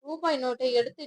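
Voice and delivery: plain reading voice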